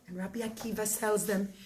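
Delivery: usual speaking voice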